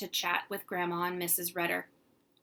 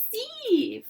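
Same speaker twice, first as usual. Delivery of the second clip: put-on much higher, louder toddler cry; gleeful toddler; 8 dB louder than usual. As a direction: crow it like a delighted toddler. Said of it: "Fudge laughed".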